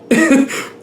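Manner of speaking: short bright burst of laughter; laughing at her own bossy answer; high laugh. Real, not acted. Answering for the viewer, short high laugh.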